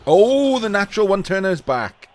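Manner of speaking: high, loud surprised exclamation; sudden delighted surprise. Real, not acted. Surprise at player returning.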